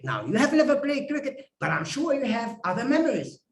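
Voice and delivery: normal talking voice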